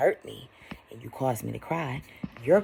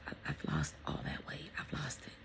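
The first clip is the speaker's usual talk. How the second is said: awed whisper; 11 dB quieter than usual. Real, not acted. Telling viewers her burden is gone, whispered. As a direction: let the relief out in a whisper. Quiet realization.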